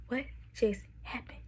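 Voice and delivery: retold hushed disbelief; stunned whisper. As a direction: whisper it, reliving the shock. Retold stunned reaction to gunfire.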